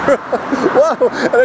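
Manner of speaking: high laughing whoa while retelling the stumble. Retold or imitated. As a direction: laugh then exclaim, high. Stumbling with bleeding face.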